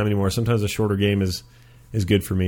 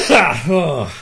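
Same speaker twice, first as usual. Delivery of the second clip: loud laugh; 5 semitones higher, 5 dB louder than usual. Real, not acted. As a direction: burst of laughter. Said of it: "Good God".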